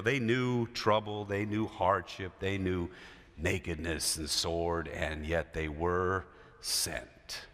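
low, slow and grave; solemn sermon cadence. Acted. Pastor to congregation, slow and grave. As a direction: lay each hardship down slowly.

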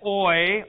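oi said as in "oil"; pronunciation demonstration. Shown as a demonstration. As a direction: say oi slowly as one glide, like oy vey. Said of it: "like this as in oil".

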